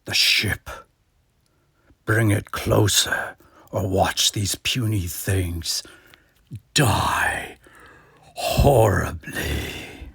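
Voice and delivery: slow, breathy menacing whisper; whispered threat. Acted. God threatening the crew in a slow whisper.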